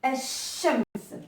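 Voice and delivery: الشمس with a pressed shin; الشمس, sh held long; doubled shin. Shown as a demonstration. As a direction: lean into the sh of الشمس. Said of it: "on doit appuyer sur cette lettre".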